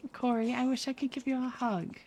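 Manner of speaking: high, soft cooing; softly lifted, consoling tone; tender sympathy. Real, not acted. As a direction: comfort a friend gently in a small high voice. Sympathy for a chatter.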